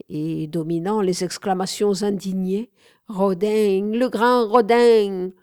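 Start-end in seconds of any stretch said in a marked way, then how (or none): 3.1-5.3 loud and drawn-out cry; shouting. Acted. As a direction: cry out the master's name over the room. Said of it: "cria Bourdelle".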